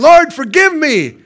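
loud, high cry for mercy; acted desperate shout to God; urgent plea. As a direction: cry out as if being thrown overboard. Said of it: "I'd have been saying".

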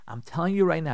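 whispered, slowing down. Shown as a demonstration. Rabbi calming his listeners, whispering. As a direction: breathe the words to calm them. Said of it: "do you hear how I'm saying it".

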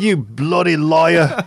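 high, slow, hammed-up British outrage; mock British accent. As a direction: bellow it like a posh Briton, stretched out. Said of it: "with British accents".

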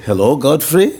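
mock Russian host's welcome; Russian accent. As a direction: imitate Russian organizers greeting him politely. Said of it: "And so they said".

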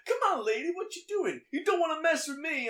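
high, loud whiny tough-guy protest; protesting thug. Retold or imitated. Handcuffed thug protesting to the undercover cop, high whiny bluster. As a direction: play the cuffed thug squealing but acting tough.

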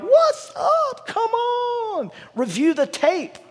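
very high, drawn-out incredulous protest; exasperated reader. Acted. Preacher playing a Bible reader appalled at forgetful Israelites, high-pitched exasperation. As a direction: comically squeal in disbelief. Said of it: "You're like".

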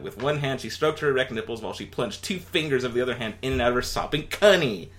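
ordinary talk

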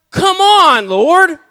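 high, loud exasperated cry; frustrated shout. Retold or imitated. Man passed over for prayer, shouting in frustration. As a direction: yell it out, feeling cheated. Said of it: "And I'm like".

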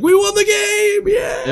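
high, slow shout of triumph; victory shout. Retold or imitated. Fan celebrating a Hail Mary, high victory shout. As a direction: yell it like a fan who just won.

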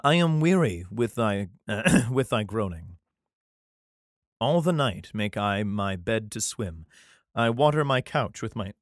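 steady, solemn reading of a psalm; scripture reading. Acted. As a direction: read it like a Bible passage. Reads the psalm aloud.

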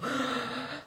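big audible breath in and out; demonstrative deep breath, imitating a child. Kid following her own calming routine, exaggerated deep breath. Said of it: "Deep breath".

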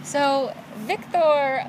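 drawn-out name in a put-on Russian accent. Storyteller introducing the Russian guy, slow Russian accent. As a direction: linger on it with a mock-Russian voice. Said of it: "I can't say his name without doing it in a Russian accent".

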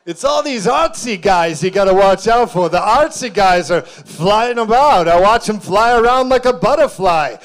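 put-on booming Italian-accented tough guy; Michael. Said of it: "a little bit of an Italian accent".

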